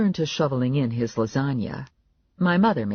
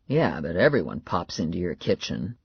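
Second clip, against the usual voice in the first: sceptical man; 7 semitones lower than usual. A narrator's reading. Narrator voices sceptical Morelli.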